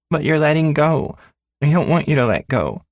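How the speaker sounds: put-on a six-year-old clinging to the handlebars; scared little girl. Little daughter telling her father she's scared, in a high child voice.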